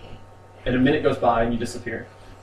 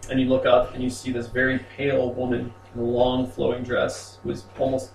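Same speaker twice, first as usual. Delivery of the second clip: normal talking voice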